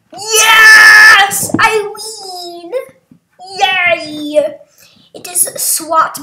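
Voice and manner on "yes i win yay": long, high shouted celebration; loud, elated gamer yell; victory cheer. Real, not acted. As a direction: cheer your win, drawing the words out. Celebrates winning the round.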